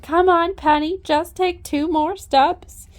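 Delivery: mock-coax her forward; coaxing the horse. Imitating coaxing the mare onto the trailer. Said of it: "be like".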